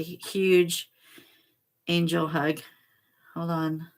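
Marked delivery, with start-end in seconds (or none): none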